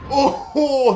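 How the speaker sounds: high, loud oh; excited oh. Real, not acted. Commentator reacting to a suplex, high excited oh. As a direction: gasp out a thrilled oh.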